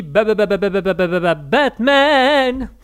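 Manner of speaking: reading a viewer's Batman joke as the theme tune; Batman theme imitation. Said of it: "Medox Volleyball qui nous dit".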